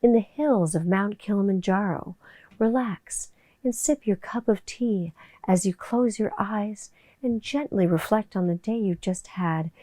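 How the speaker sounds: soft, slow meditation read; hushed, slow relaxation voiceover; relaxing voice. Shown as a demonstration. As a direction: ease the listener into calm. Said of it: "I have to do my relaxing voice".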